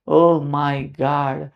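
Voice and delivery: very slow, soft, hammed-up oh my God; mock shock. Teacher pretending to be stunned by a charged molecule, slow and soft. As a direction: drag it out in fake dismay.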